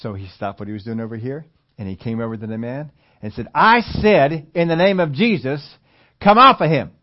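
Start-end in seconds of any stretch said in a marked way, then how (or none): 3.5-6.9 loud, high command to the demon; commanding shout. Retold or imitated. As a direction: shout the rebuke with full authority.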